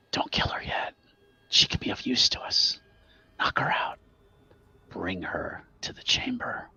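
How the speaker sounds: unvoiced ghostly grandmother whisper; frail shaky old whisper. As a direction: whisper orders like a dead witch. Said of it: "Jesse now hears Grandma's voice".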